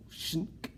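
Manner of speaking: unvoiced slicing sound; whispered slicing noise; whispered knife shink. A narrator's reading. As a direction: make the blade sound.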